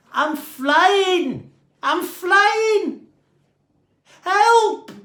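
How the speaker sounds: very high, loud cries of flight and fright; panicked wail. A narrator's reading. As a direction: shriek it out, half thrilled, half terrified. Dave floating, panicking.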